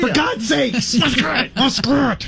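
high-pitched, hammed-up exasperated holler; mock yell. Yelling the code word at Tracy, mock exasperated yell. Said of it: "yelled across a party".

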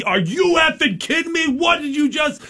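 put-on yelling at the kids down the alley, outraged; retold yell. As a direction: shout it up high at the kids. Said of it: "So I start yelling at him".

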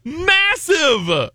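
laughing, high excited shout. Real, not acted. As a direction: burst out with glee. Excited park description climax.

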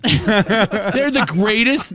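slow, drawn out through laughter; laughing. Real, not acted. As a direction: gush while laughing.

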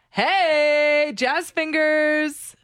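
high, slow sing-song taunt; mock the guy with a drawn-out taunt. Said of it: "And he's like".

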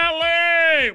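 high, long yelled name; imitated yell. Host mimics fishing partner yelling to angler, mock yell. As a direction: holler it out high like an excited fishing buddy. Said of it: "Brett Kiesel yelling".

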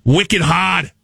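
hammed-up Boston accent; mock Boston slang in a Boston accent. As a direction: do an annoying Bostonian, dropping the r. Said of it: "is also Boston".

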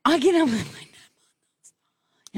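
breathless whisper; running out of air mid-sentence; out of breath. Real, not acted. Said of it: "You ran out of breath".

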